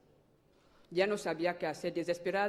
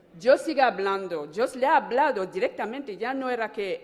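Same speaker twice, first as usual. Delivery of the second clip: fervent preaching; 3 semitones higher, 9 dB louder than usual. Real, not acted. Woman exhorting the congregation that God still speaks, loud preaching. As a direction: preach it to the whole church.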